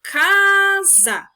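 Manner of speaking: loud CASA with a long held CA; drawn-out, strong CA in CASA; word called out. Shown as a demonstration. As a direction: call "casa", stretching the first syllable hard. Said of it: "Vou dar um exemplo pra modelizar".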